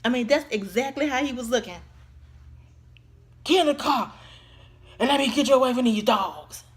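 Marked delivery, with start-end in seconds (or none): 3.4-6.6 loud mock-menacing order from a stranger; imitated stranger. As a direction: call out like a creepy man luring someone. Acting out the man in car.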